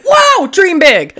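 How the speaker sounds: loud, high, drawn-out movie quote; hammed-up, soaring exclamation; mock amazement. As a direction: belt it out slowly like a big film line. Quoting Juno movie with gusto.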